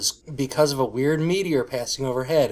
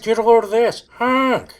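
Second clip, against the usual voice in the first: silly clown; 8 semitones higher than usual. Pretend. Goofy honk bit for friends.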